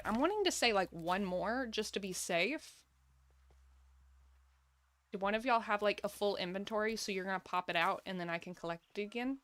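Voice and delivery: ordinary talk, nothing marked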